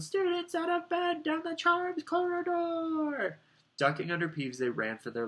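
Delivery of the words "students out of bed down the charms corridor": very high, slow, stretched-out yell; bellowing. A narrator's reading. Peeves telling Filch where the students are, bellowing. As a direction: call it down the corridor, high and long. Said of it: "Peeves bellowed".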